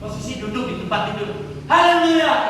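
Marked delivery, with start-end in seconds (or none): 1.7-2.5 high, loud praise shout, falling at the end; retold shout. Man sitting up in bed, startling his wife, shouting praise. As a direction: re-enact a sudden cry of praise. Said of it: "Bangun-bangun langsung teriak".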